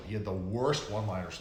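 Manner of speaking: ordinary talk, nothing marked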